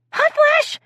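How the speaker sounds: very high, loud cry; high exclamation. Acted. Improv player excuses tearing off her shirt, high cry. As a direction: squeal the excuse theatrically.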